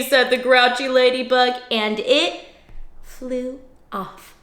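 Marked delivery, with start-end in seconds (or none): none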